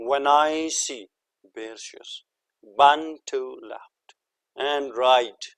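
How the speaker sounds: loud, slow recital of a verse, beats marked; recited poem line. Shown as a demonstration. Teacher loudly recites a Frost line as a metre example. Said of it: "जैसे कि example आप देखें".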